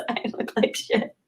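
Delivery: breathy whisper of a comic line; whispered joke; whispered aside. Pretend. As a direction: drop to a whisper, half laughing. Reading a viewer's comment aside.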